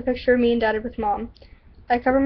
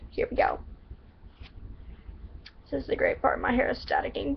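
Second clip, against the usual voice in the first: ordinary delivery, nothing marked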